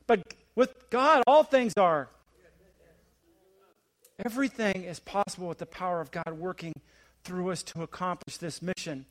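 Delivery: ordinary delivery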